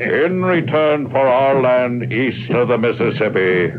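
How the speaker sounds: rising, grand treaty declaration; ceremonial proclamation. Acted. Chief dictates the treaty aloud.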